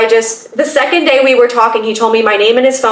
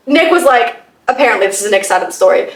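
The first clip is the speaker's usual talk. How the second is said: ordinary talk, nothing marked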